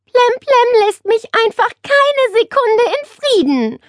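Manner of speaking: high-pitched moaning; whining complaint. A narrator's reading. Nele moaning to her aunt about the parrot, whining. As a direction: whine it high, exasperated. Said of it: "jammerte Nele weiter".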